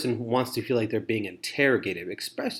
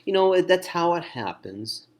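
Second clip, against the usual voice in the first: raised-pitch, hammed-up consolation; mock consoling; 6 semitones higher, 4 dB louder than usual. Poor listener trying to comfort a person, mock consoling. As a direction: console them in a higher voice, slowing at the end. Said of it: "You're not here to console them".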